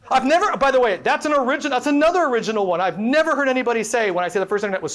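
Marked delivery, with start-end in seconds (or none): none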